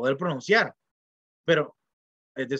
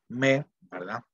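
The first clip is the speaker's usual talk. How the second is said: usual speaking voice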